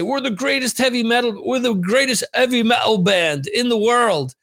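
badly faked British accent, boasting on TV; lame British accent. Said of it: "that's my lame British accent".

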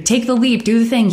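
parroting glib advice with light sarcasm; mocking the cliché. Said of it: "People who's like".

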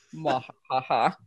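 hammy evil villain laugh; sneering panto villain. Pretend. Mock evil gloat about otters.

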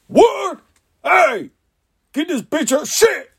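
high, sharp comic yell; sudden high-pitched outburst; mock yelling. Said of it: "he always be yelling".

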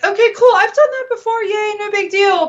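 high, chirpy, carefree imitation; breezy past self. Retelling her early self, high and chirpy. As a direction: play it as naively unbothered. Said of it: "And I'm like".

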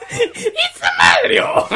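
high, drawn-out cartoon Italian shout; Mario. Player doing a video-game plumber on request, cartoon Italian accent. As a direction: stretch it out in a squeaky Italian accent. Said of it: "Can you do, uh, Mario?".

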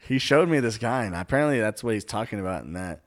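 ordinary talk

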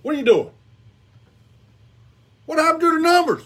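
usual speaking voice

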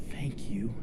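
faint, whispered thanks; weak whisper. Acted. Head voice thanks you as you walk to light, weak whisper. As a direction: barely whisper it, subdued. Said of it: "the voice kind of weakly goes".

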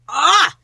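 loud, high-pitched cry; sudden high burst of swooning; fan scream. Real, not acted. Fan screaming over a hot scene.

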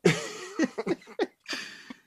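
loud high burst of laughter. Real, not acted. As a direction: laugh hard. Big laugh.

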